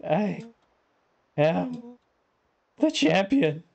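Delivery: high, boastful victory announcement; triumphant. Acted. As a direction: proclaim it like a champion. Race winner boasting.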